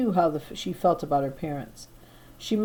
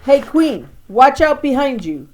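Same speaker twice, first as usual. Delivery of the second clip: warning shout; 7 semitones higher, 8 dB louder than usual. Acted. Warning the queen mid-battle.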